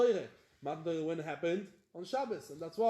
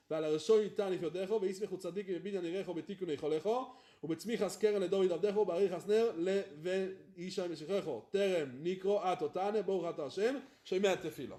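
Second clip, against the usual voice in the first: racing through the prayer text, very fast; quick rote mumble of the liturgy; rattled off fast; much faster than usual. Real, not acted. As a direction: rush the words like a rote recital. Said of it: "inside, slowly".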